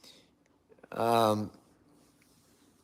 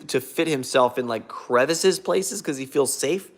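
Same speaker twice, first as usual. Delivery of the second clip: ordinary talk, nothing marked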